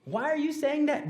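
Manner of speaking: lightly voiced puzzled child; a hint of a young student asking; curious kid.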